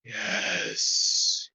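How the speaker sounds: a looming beast savouring its threat; monstrous hyena voice. Acted. Giant two-headed hyena looks down and pronounces doom on the dreamer.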